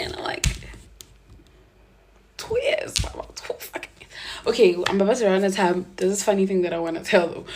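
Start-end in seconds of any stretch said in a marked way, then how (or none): 2.4-4.1 soft disbelieving whisper; bitter whisper. Retold or imitated. Muttering to herself about two years together, bitter whisper.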